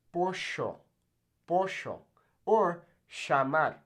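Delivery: "pollo", "llamar" as po-sho, sha-mar in Argentine accent. Shown as a demonstration. Teacher shows how Argentina says it, Argentine accent. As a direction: Argentine Spanish, double L as sh. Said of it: "in places like Argentina".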